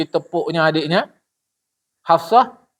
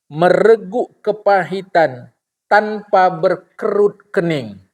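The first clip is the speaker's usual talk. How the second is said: drawn-out, very slow saying; slow solemn quote; slower than usual. Acted. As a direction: savour each word of the saying. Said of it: "Dia jawab". (kutipan lambat dan khidmat)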